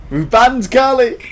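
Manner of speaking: high, loud triumphant yell; gleeful shout. Real, not acted. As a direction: crow about the ban with glee. Gleeful shout at teammates' ban.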